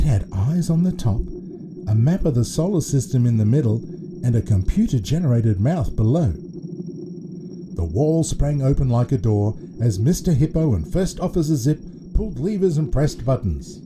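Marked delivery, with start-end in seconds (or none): none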